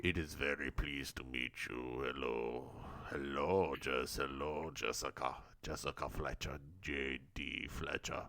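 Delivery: very low, hushed mock-Russian voice; Sling Blade with a Russian accent. Host imitating the fat man greeting Jessica, comic Russian accent. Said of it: "It's Sling Blade with the Russian accent".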